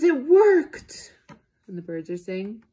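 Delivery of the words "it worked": very high, loud delighted cry; thrilled cheer. A narrator's reading. Elephant cheering that asking nicely worked, high and loud. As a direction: cheer with joy.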